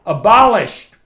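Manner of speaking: high, loud preaching cry of one word; shouted proclamation. Real, not acted. Preacher to congregation, a high ringing shout. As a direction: ring it out to the whole church.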